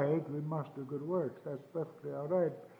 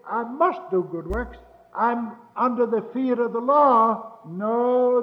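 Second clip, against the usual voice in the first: full-force preaching; 6 semitones higher, 10 dB louder than usual. Acted. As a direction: hammer each question home to the congregation.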